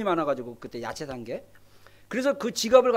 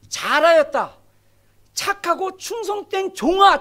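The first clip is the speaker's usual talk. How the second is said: joyful praise; 5 semitones higher, 5 dB louder, slower than usual. Acted. Jesus praising faithful servant.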